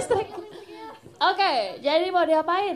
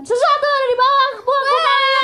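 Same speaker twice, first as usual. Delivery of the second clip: announcer shout; 9 semitones higher, 10 dB louder than usual. Real, not acted. Announcing gifts to the audience.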